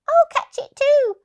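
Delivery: high, loud eager little dog; a tiny dog joining the chase. Acted.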